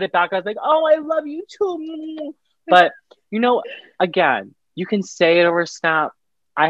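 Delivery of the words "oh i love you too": much higher, slow put-on sweetness; mock sweet reply. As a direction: coo it back in a slow, high voice. Retold reluctant reply to boyfriend.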